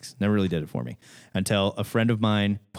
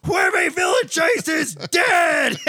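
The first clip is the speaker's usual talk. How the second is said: high, drawn-out hammed-up scream; retold scream; 5 dB louder, slower than usual. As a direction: scream it slowly as two rampaging cartoon characters. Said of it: "they both stand up and they scream".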